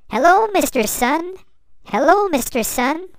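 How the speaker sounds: high, loud happy shout of hello; excited shout. A narrator's reading. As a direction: shout a cheerful greeting up to the sun. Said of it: "Little Star shout out".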